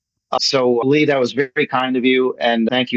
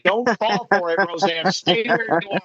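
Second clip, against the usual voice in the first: raised, theatrical plea to stay put; dramatic mock warning; 5 semitones higher than usual. Host playfully warning Roseanne off a fake letter, in a theatrical mock warning. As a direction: warn her like a melodramatic Holmes story.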